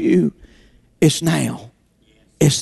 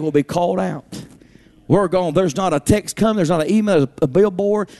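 normal talking voice

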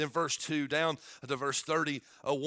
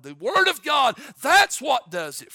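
shouted high and loud, holding every phrase; fiery preaching; 12 semitones higher, 13 dB louder, slower than usual. Acted. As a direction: thunder it at the church like a revival.

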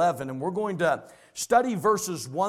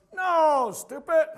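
much higher, louder, scornful retort; retold girl's retort; 10 semitones higher, 4 dB louder, much slower than usual. Girl correcting a classmate, high and scornful. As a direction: snap it back like a teen girl. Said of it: "she goes".

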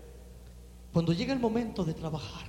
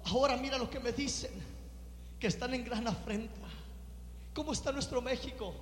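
impassioned preaching; 8 semitones higher than usual. Acted. Pastor to congregation on Nehemiah's grief, impassioned preaching. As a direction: preach it with fire and ache.

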